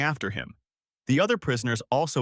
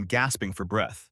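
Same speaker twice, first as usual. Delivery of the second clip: ordinary delivery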